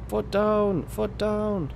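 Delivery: high-pitched drawn-out call, dropping at the end; high, insistent holler; urging shout. Real, not acted. Urging slow truck to go faster.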